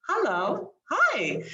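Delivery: high perky hello. Shown as a demonstration. Speaker demoing different hellos to audience, high voice. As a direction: pitch the greeting way up, playfully. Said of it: "you have a hello".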